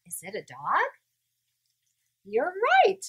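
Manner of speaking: plain reading voice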